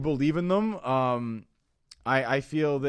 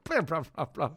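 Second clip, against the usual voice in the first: alien voice; 3 semitones higher than usual. Pretend. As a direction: babble like a dopey alien. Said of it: "Apparently they sound stupid".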